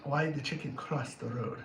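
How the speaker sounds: low, soft put-on voice; hushed joke setup. Clown telling a riddle to Jeremiah, hushed and low. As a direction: murmur the riddle conspiratorially.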